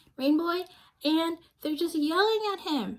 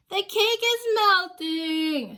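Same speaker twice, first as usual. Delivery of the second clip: loud, higher, slow wail of dismay; shouted; 7 semitones higher, 5 dB louder than usual. Acted. Star shouts at the party as the cake melts. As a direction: shout out the disaster. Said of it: "shouted Star".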